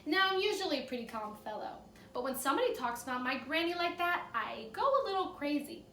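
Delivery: expressive read-aloud storytelling; cheerful classroom story reading; story narration. A narrator's reading. Teacher reads the story aloud.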